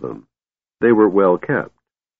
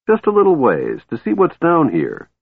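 much higher, wheedling; eager coaxing; 9 semitones higher than usual. Acted. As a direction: tempt him further. Kender coaxing dwarf.

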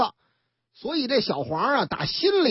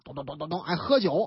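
ordinary delivery

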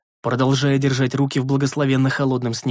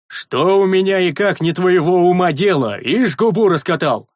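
louder, slow gruff rebuke; gruff scolding; 5 semitones higher, 5 dB louder than usual. A narrator's reading. Rikar scolds the sentry, gruff. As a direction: scold the cheeky boy.

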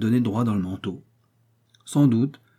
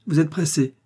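sharp, high indignant cry; cried out; 6 semitones higher, 3 dB louder than usual. A narrator's reading. Wounded musketeer crying out at the rude young man. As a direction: throw his words back at him. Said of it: "s'écria le mousquetaire".

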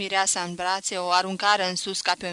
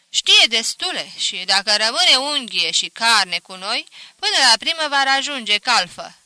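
loud, confident counter-opinion; emphatic disagreement; 3 semitones higher, 9 dB louder than usual. A narrator's reading. Brother vouching for the apprentice, emphatic and loud. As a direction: speak up firmly for the lad.

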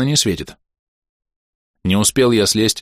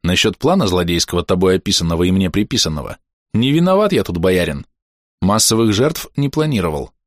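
ordinary delivery

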